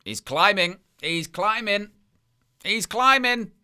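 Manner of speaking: rising excitement. Real, not acted. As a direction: climb the pitch with each call. Repeated call, rising pitch.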